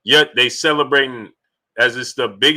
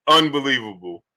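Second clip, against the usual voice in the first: usual speaking voice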